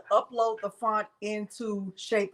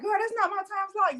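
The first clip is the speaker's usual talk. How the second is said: friend quoted; 11 semitones higher than usual. Retold or imitated. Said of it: "She's like".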